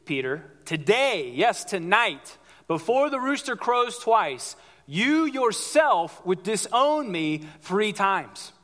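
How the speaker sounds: slow, weighty prophecy to Peter; solemn warning. Retold or imitated. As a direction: stretch each phrase out gravely. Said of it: "He says".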